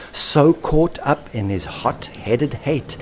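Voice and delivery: plain reading voice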